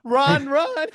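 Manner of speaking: giggled fake alarm; laughing joke. Co-host cutting in laughing, high mock panic.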